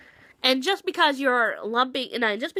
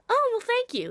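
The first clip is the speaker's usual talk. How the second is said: high polite thanks; 11 semitones higher than usual. Retold or imitated. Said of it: "I'm gonna get the sentiment and be like".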